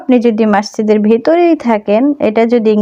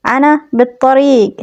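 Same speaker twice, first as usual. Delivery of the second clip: slow, careful model phrase; slow teacher's demo of আনা বিত্তরিক; slow Arabic; 4 semitones higher, slower than usual. Shown as a demonstration. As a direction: say it slowly so students can copy. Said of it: "খুবই সহজ".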